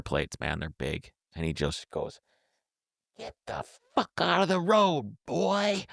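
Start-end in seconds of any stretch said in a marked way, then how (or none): 3.2-5.9 put-on high-pitched, yelping Southern accent. Wild-eyed driver orders him off the road, Southern accent. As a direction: holler it like an unhinged Southern local. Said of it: "in this southern accent".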